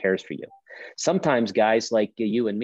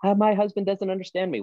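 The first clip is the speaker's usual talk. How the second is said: high-pitched mock-tearful complaint; put-on high woman's whine; mock crying wife; 11 semitones higher than usual. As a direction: imitate an upset wife in counseling, much higher. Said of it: "she's crying and counseling".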